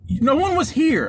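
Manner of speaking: high-pitched indignant protest; mock-outraged retort; retold heated comeback. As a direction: act out arguing back, exasperated. Retold argument, own comeback.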